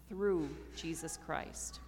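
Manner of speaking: ordinary talk, nothing marked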